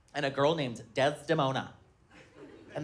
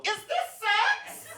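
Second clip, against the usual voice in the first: put-on much higher, very slow tiny child voice; innocent little girl; much slower than usual. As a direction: ask it wide-eyed and innocent. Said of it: "innocent angel go".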